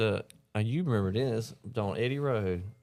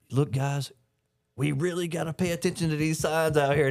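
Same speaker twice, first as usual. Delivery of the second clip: mocking boss voice; 7 semitones higher than usual. Boss voiced in retold story.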